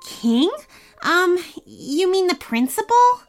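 put-on hesitant boy, voice rising in question; slightly confused kid. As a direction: query it uncertainly in a child's voice. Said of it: "the kid asked, slightly confused".

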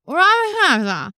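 Lamaha stretched out high and slow. Shown as a demonstration. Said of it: "practice saying it".

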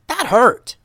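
high, loud complaint; indignant yell. A narrator's reading. Ninja hit hard in the face yells in protest. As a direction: yell it high, stung and cross. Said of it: "yelled Tatiana".

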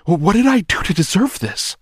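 high, loud terrified pleading; panicked whine. A narrator's reading. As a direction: beg in sheer panic. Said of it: "he whined".

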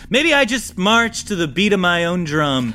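smooth high-status schemer. Acted. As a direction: toy with them like a cool con man. NPC Snake Professional speaking.

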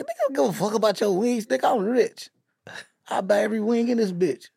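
high teasing rich-guy boast; high mock rich brag. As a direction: brag like a rich man flexing. Faison quoted bragging.

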